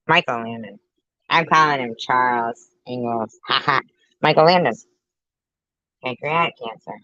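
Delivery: ordinary talk, nothing marked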